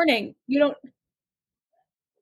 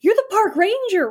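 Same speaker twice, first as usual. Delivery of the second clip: bouncy dude voice; 7 semitones higher than usual. Acted. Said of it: "He's like".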